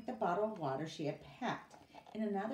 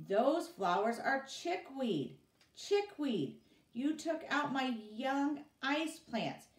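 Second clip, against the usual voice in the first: louder, higher, slow angry scolding; angry correction; 8 semitones higher, 5 dB louder, slower than usual. Acted. Boss scolding the workers, loud angry correction. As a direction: scold them for the mistake.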